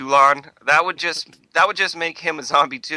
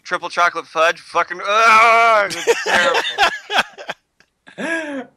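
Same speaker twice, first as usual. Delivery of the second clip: angry note; 4 semitones higher, 4 dB louder than usual. Retold or imitated. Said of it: "I wrote it in huge letters 'cause I was angry".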